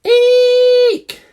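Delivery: very high, very loud owl screech; ear-splitting owl shriek. Acted. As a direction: screech as loud as you can. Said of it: "Now really loudly, come on".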